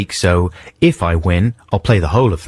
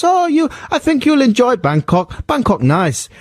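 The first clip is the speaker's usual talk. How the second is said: high, put-on Thai accent; mock Thai accent, pitched high. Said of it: "accent I did there as well".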